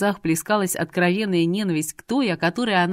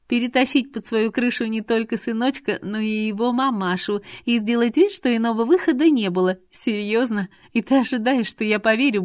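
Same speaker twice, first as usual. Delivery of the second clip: woman's part, mostly pitched up; scornful Irina; 3 semitones higher than usual. Acted. Irina accuses Artur of lying to himself, scornful. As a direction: needle him with rising disbelief.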